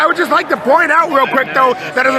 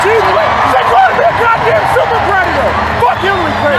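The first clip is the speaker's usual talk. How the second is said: furious, much higher and shouting back; angry yelling; 8 semitones higher than usual. Real, not acted. Delegate yelling back at Clinton supporters, furious. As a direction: yell it back in real fury. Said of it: "I shouldn't have yelled back".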